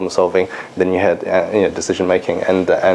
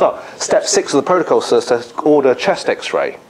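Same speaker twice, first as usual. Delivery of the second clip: higher, slow, by-the-book recitation; earnest recital; 4 semitones higher than usual. Retold or imitated. Resident answering the professor from the protocol, earnest recital. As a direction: imitate a dutiful junior quoting the rules.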